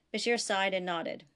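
usual speaking voice

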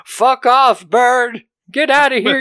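high, loud mock yell at a bird; old man shouting a bird off the window; ranting at a crow. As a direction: shoo the crow away in a hammed-up rant. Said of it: "while he's ranting".

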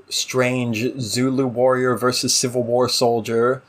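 ordinary talk

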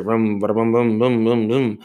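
imitated chainsaw rumble.

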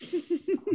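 short, high giggle; high laugh. Real, not acted. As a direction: laugh at the absurd fine. Laughs at fine story.

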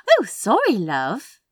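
high, lilting smiling apology; friendly hostess. A narrator's reading. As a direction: apologise in a sing-song, smiling way.

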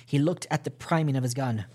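usual speaking voice

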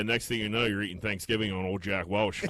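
ordinary talk

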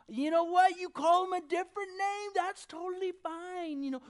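high, loud mock-agreeable voice; breezy tolerance, mocking. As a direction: play the easygoing universalist, hammed-up. Voicing universalists' easy tolerance.

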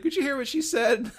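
laughing quote. Retold or imitated. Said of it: "He's like".

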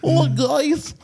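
quoting the comedy movie guy, in a high dopey voice; Ridiculous Six character voice. Retold or imitated. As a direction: whine it like a dopey movie sidekick. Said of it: "the guy from The Ridiculous Six".

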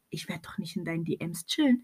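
hushed, breathless retelling of the retort; retold whisper. Retelling what she told him, whispered. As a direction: drop to a whisper for the comeback. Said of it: "ich so".

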